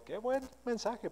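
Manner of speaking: high, very soft imitated compliment; admiring congregant. Churchgoers praise the pastor's old sermon, soft high imitation. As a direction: lift the pitch softly, imitating a churchgoer's praise. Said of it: "donde me dijeron".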